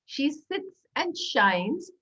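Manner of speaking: very slow, careful; slow tongue twister. Shown as a demonstration. Teacher demonstrating the tongue twister slowly. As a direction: savor each sound of the twister. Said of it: "If you say it really fast".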